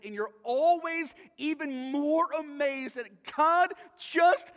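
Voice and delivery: high, loud preacher's emphasis; impassioned preaching. Acted. Preacher to congregation on being saved by grace, impassioned.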